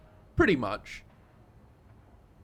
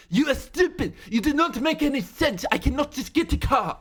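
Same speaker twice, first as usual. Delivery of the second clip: much higher, louder mock French shriek; outright screaming; 12 semitones higher, 6 dB louder than usual. Classmate screaming at Seth in a train station, shrill mock French shriek. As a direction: scream it at him in a put-on French accent. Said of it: "her just outright screaming".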